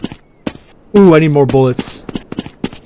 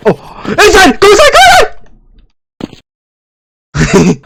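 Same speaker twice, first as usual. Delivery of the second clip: very high, frantic cry; panicked urging; 14 semitones higher, slower than usual. Real, not acted. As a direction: urge a desperate escape, shrieking. Fleeing indoors, nearly dead.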